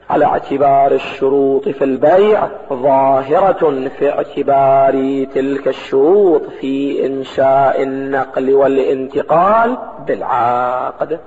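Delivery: low formal reading, last words drawn out; measured text reading. Acted. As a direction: read the passage out low and deliberately. Reads the source text aloud.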